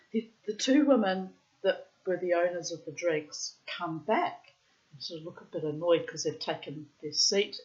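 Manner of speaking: ordinary delivery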